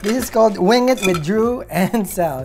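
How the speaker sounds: normal talking voice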